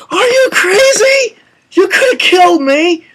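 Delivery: hammed-up high-pitched outrage; outraged mock shout. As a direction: imitate someone yelling after a near miss. Voicing Statham shouting at Stallone.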